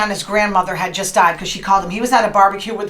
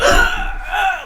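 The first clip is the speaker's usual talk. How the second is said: heart attack gasp; 11 semitones higher than usual. Retold or imitated. Host acting out Michael Douglas at lunch, high mock heart-attack gasp. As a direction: mimic a heart attack with strained gasps. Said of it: "like he was having a heart attack".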